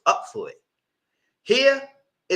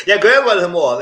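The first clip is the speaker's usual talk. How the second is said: loud emphatic repeat; 4 semitones higher, 11 dB louder than usual. Real, not acted. Host repeating her point to viewers, loud and emphatic. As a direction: say it again loud after laughing.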